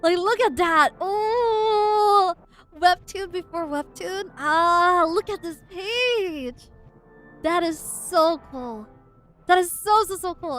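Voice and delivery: loud, high, thrilled squealing; excited gushing. Real, not acted. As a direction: gush over something amazing. Gushing over the comic page.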